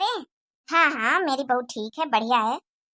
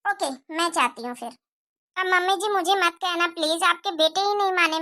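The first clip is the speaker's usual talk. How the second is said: ordinary talk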